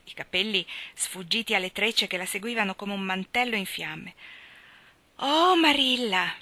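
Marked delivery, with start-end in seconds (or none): 5.2-6.3 slow, higher, breathless oh; Anna running in breathless; breathless exclamation. A narrator's reading. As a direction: gasp out her name in excitement. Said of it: "esclamò senza fiato".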